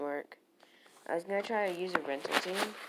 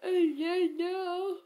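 mock crying; 12 semitones higher, 4 dB louder, much slower than usual. Real, not acted. Game moment overwhelms him.

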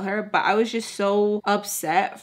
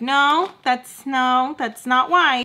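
higher, louder, slowing protest; mimicked denial; 5 semitones higher, 6 dB louder, slower than usual. Missus Peach corrects Sam, high emphatic mimicry. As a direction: act out her protest, pitched up.

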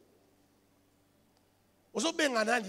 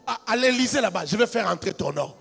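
loud, high sermon climax over music; fiery preaching; 8 semitones higher, 16 dB louder than usual. Acted. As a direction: preach it full force to the crowd, half singing. Preaching at full force.

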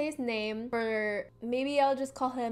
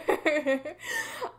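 high half-sobbing laugh; laugh-cry; 6 semitones higher than usual. Real, not acted. She laugh-cries recalling the bathroom. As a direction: laugh through fake tears.

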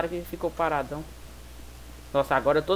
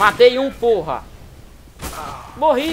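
loud excited shouts; panicking gamer; 4 semitones higher, 8 dB louder, slower than usual. Real, not acted. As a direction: yell it mid-firefight. Gamer yelling during firefight.

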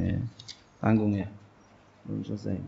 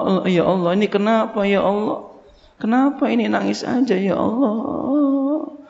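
higher, pleading question to God; bewildered prayer; 9 semitones higher than usual. Retold or imitated. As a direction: ask God why the tears won't stop. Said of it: "bertanya kepada Allah". (doa bingung yang memelas)

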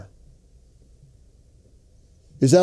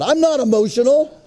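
high-pitched protest of innocence; mock indignant denial; 7 semitones higher than usual. Husband denies his wife's charge, in high mock indignation. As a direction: protest too much, pitch jumping up.

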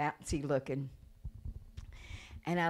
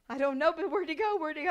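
high, rushed disbelief; retold amazement, fast and high-pitched; astonished mother; 13 semitones higher, 6 dB louder, faster than usual. Mother astonished tumor is gone.

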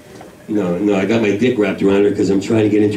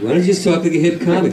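ordinary talk

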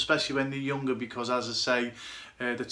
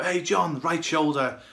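higher, louder call out to a teammate; mock shout; 4 semitones higher, 4 dB louder, slower than usual. Goalkeeper alerting the left back during a game, mock shout. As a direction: demonstrate a keeper's shout, raised but not full force. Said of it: "sometimes you gotta shout to your left back".

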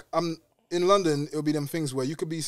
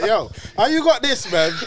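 louder, higher, laughing question; laughing amazed quote; 7 semitones higher, 9 dB louder than usual. Retold or imitated. Imagined asking a same-age friend, laughing amazement. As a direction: ask it half laughing. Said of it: "You're like".

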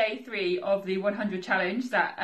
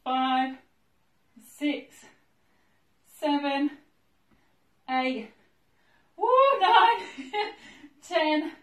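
higher-pitched counting, climbing as the set goes on; straining with effort; 6 semitones higher, 4 dB louder, slower than usual. Real, not acted. Exerciser counting her second set of hip thrusts, straining. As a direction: count reps through the burn, giggles breaking in.

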